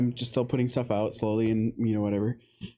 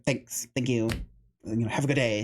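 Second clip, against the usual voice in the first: imitating a snippy, bratty customer. Customer curtly thanking the garage sale seller, imitated as snippy. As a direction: toss off mock-polite thanks, snippily. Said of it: "really snippy with me".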